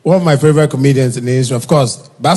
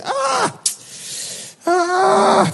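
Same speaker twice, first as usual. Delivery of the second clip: shocked gasp; 6 dB quieter than usual. Retold or imitated. Shocked that Bright is at the gate.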